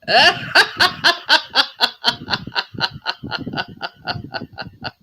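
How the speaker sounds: long hearty laugh. Real, not acted. Host laughing at his own pasty story. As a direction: burst out laughing.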